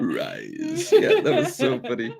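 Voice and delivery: high, giggly laughing speech. Real, not acted. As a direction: laugh through the words. Reacting to funny comment.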